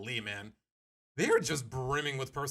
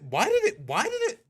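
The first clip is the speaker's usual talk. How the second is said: baffled; 12 semitones higher, 7 dB louder than usual. Real, not acted. Baffled by autoplay start time.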